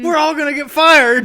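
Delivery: high, loud panicked cry; frantic shriek of alarm; panicked pilot. Acted. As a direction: yell it like a doomed pilot. Panicked pilot quote from show.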